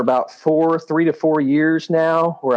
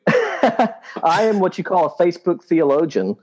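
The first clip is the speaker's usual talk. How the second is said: laughing; 5 semitones higher than usual. Real, not acted. Laughs about Facebook posting.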